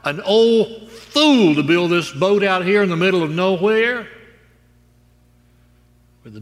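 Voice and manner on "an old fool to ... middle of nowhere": loud, high-pitched ridicule; hammed-up scoffing shout; mocking scoffer. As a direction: jeer at him like a scornful crowd. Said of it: "ridicules and mocks and scoffs at Noah".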